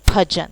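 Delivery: भजन कहते हुए ज़ोर से; शब्द को ज़ोर देकर बोलो; stress on M. Shown as a demonstration. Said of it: "सिर्फ और सिर्फ एम पे एम्फसाइज किया जा रहा है". (ज़ोर देकर)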